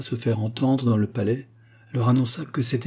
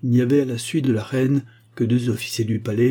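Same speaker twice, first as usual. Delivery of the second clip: ordinary delivery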